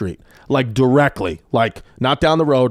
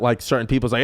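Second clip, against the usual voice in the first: ordinary talk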